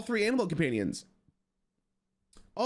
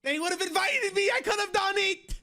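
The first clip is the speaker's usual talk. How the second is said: frustrated shout; 14 semitones higher than usual. Real, not acted. Streamer venting to chat about the visa and border, frustrated shout. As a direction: yell it like you just missed your chance. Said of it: "I'm so mad".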